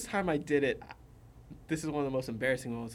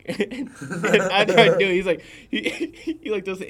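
laughing; 7 semitones higher, 12 dB louder than usual. Real, not acted. Recalling his embarrassing cloud voice, laughing. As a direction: laugh the line out, much higher. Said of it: "I shot that exact target".

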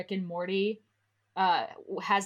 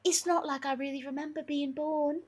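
higher put-on boy-wizard voice; Harry; 8 semitones higher, 4 dB quieter than usual. Harry answering the old man's question, higher character voice. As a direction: play the young wizard, pitched up.

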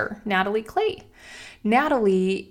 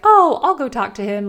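outgoing, friendly mom; 5 semitones higher, 8 dB louder than usual. Retold or imitated. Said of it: "she's the outgoing, friendly one".

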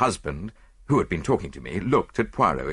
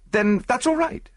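quick acceptance pitched high; reply hastily with a high lift; hasty reply. A narrator's reading. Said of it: "I said hastily".

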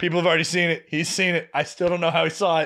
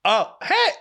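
surprised greeting; 12 semitones higher, 4 dB louder than usual. Retold or imitated. Buddy spots him, then flees.

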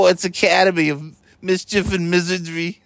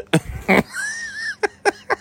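high squealing laugh; shrieking laugh. Real, not acted. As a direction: shriek with laughter while tickled. Being tickled.

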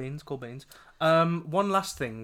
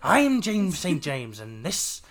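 camp show host; 3 semitones higher, 6 dB louder, slower than usual. Retold or imitated. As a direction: present it like a campy TV host. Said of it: "when he's like".

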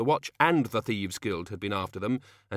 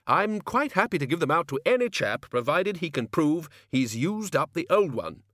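higher, emphatic self-justifying wizard; blustery senior wizard; 6 semitones higher, 3 dB louder than usual. Acted. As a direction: declare your own generosity in a higher, hearty voice.

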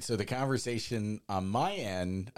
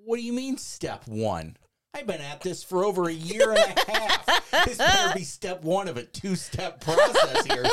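high, loud exasperation breaking into laughter; irritated; 10 semitones higher, 7 dB louder than usual. Retold or imitated. Man arguing with God in his car, laughing and exasperated. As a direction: protest to God, half laughing. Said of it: "So you're irritated too".

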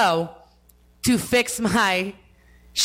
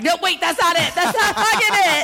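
higher, louder flustered no wait; panicked retold protest; 9 semitones higher, 4 dB louder than usual. Showing the cop the wrong card, flustered protest. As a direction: replay the moment of panic. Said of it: "I'm like".

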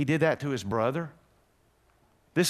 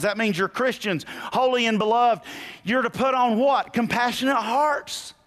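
raised, loud urgent preaching; 8 semitones higher, 4 dB louder than usual. Acted. Preacher urges the congregation to put on kindness, preaching loudly. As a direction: exhort the church to put on love. Preaching Colossians at full force.

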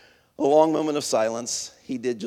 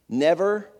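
ordinary delivery, nothing marked

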